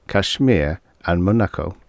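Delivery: plain reading voice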